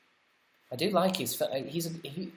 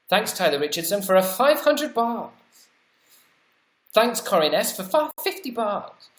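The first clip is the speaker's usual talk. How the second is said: excited thanks, rising; 4 semitones higher, 4 dB louder than usual. Real, not acted. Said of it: "it's making me dizzy now".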